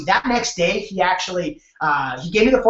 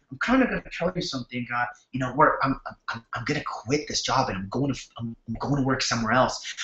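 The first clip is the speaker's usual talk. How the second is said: low, soft, fast and halting confession; hesitant low mumble; 8 semitones lower, 8 dB quieter than usual. Retold or imitated. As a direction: mumble the news that you're quitting, low and uneasy. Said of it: "I still remember the way he said it".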